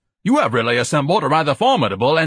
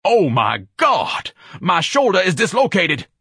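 loud, melodramatic wail; high, indignant howl of pain; pained outcry; 10 semitones higher, 5 dB louder, slower than usual. Acted. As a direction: cry out in theatrical agony. Slams into a post.

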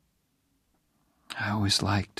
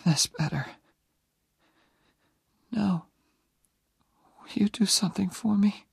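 put-on high, weak whisper of a dying young woman; dying girl's whisper; 10 semitones higher than usual. Dying Jenny asking Oliver to stay near, whispered. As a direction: breathe it out, barely there.

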